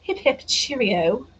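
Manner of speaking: slow, playful mock-British cheer; hammed-up British accent. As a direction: put on a jolly British send-off. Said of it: "Let's do a British accent".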